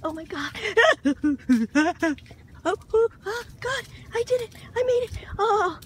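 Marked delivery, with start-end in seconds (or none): 0.6-2.6 loud nervous laugh; giddy high laugh. Real, not acted. Woman laughs loudly as she steps in. 2.7-5.8 high, loud, giddy cries; excited squeal. Real, not acted. Woman who waded into the water squeals that she made it. Triumphant after wading in.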